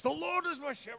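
high, ringing preacher voice; preaching to myself. Retold or imitated. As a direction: proclaim it like a sermon. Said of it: "I start preaching to myself".

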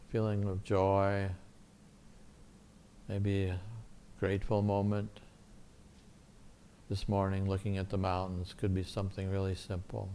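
soft, calm guided meditation voice; soft spa whisper. Acted. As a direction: gently guide them through a quiet prayer. Leads guided prayer meditation.

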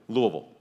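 Louisville squeezed to LOO-uh-vul; Louisville run together the local way, "LOO-vul"; swallowed middle. Shown as a demonstration. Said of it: "Just swallow the middle part".

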